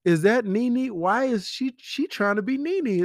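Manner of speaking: high-pitched, incredulous questioning; mock sudden interest. Five minutes into a Housewives episode, mock sudden interest. Acts out getting hooked on Housewives.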